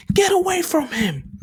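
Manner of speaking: high, loud yell at the villain; heroic yell. Acted. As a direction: shout him off the kid. Zuko yells at the villain.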